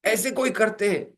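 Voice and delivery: scolding. Retold or imitated. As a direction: scold with rising pitch. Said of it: "ये डांट रहा है".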